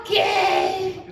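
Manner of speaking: very high squeaky falsetto, desperate woman; shrill over-eager falsetto on the phone. Acted.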